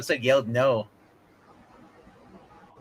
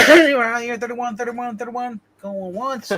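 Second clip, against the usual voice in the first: auctioneer; 9 semitones higher, 5 dB louder than usual. Pretend. Mock auction, calling the bids.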